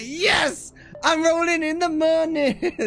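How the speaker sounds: high-pitched gleeful celebration; mock delight. Waitress chuffed with her tip, high gleeful imitation. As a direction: crow it like a jackpot winner.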